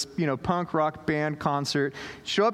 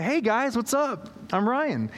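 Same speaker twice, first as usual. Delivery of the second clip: higher, upbeat dad-like hello; awkward cheery greeting; 6 semitones higher than usual. Retold or imitated. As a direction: greet the teens a bit too eagerly. Awkward adult greeting skater kids.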